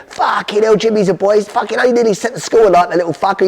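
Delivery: high, delighted mock outburst; proud dad. Dad on the phone, proud of his arsonist son, high gleeful swearing. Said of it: "He went".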